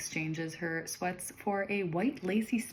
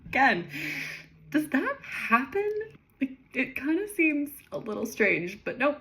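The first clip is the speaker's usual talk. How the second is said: incredulous; 8 semitones higher, 5 dB louder than usual. Real, not acted. Reader mocking the plot.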